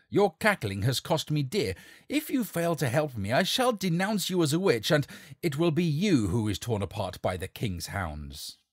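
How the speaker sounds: angry threat. Acted. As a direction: threaten her so she obeys. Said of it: "roared the charlatan".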